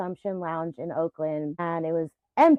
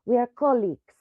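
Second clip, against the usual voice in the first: higher, emphatic imitation of a Colombian accent; partner's Colombian accent; 5 semitones higher, 4 dB louder than usual. Quoting her Colombian partner to friends, in his accent. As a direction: mimic a Colombian man's accent with conviction. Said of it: "I can't not do his accent".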